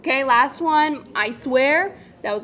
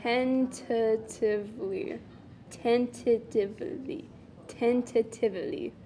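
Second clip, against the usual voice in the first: mocking repetition; 9 dB quieter than usual. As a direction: savour the fancy word mockingly. Mocking the fancy word.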